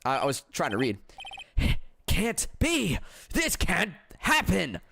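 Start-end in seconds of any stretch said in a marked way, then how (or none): 2.1-4.8 raised pitch, stretched-out dismay; mildly hammed-up horror; shocked disbelief. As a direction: stagger over it in disbelief. Voices character's shocked disbelief.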